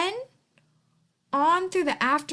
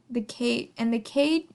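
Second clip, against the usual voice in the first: plain reading voice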